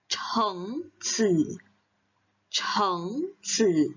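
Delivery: "Chengzi" with a breathy aspirated ch. Shown as a demonstration. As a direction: push air on the "ch". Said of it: "ada H-nya". ("Chengzi" dengan bunyi ch beraspirasi)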